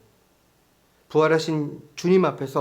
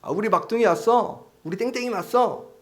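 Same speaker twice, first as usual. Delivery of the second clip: high, sweet welcome home to the youngest; affectionate parent greeting; 9 semitones higher, 3 dB louder than usual. Pretend. Parent greeting a guilty child with a high, loving coo. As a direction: coo the pet name like a doting parent. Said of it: "사랑스러운 애칭으로 저를 부르신다고".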